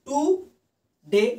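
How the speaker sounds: টুডে pulled apart as টু, long pause, ডে; split in syllables. Shown as a demonstration. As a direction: say টুডে very slowly in two separate beats. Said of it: "দুইবার বলতে দেখো". (টুডে দুই ভাগে থেমে থেমে বলা)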